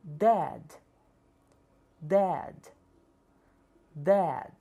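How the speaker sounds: dad with plain d at both ends; hard English d. Shown as a demonstration. Teacher models dad with hard d. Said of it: "Iremos pronunciar".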